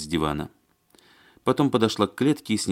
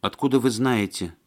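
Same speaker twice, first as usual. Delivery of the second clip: higher-pitched, wary question; suspicious; 6 semitones higher than usual. A narrator's reading. Маня questioning the man who contradicted her, suspicious. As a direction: ask it with suspicion. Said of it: "подозрительно спросила Маня".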